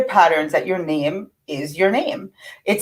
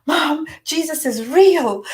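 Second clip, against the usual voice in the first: put-on higher, slow, amazed young woman's voice; imitating daughter; 8 semitones higher, slower than usual. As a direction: play the thrilled daughter calling her mom. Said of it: "she's like".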